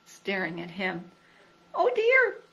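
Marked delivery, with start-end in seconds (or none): 1.7-2.4 high little squeak of worry; squeaky Piglet. A narrator's reading. Piglet squeaking when all the animals stare at him. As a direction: squeak it out like a small nervous pig. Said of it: "he squeaked".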